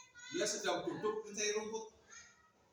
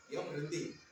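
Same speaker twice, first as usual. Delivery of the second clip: usual speaking voice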